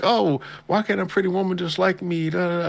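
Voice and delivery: high, mock dreamy lovestruck whine; mock naive guy. Retelling the anime, mocks the smitten guy in a high whine. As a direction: lampoon his naive hope in a high whine. Mocking friend who thinks woman likes him.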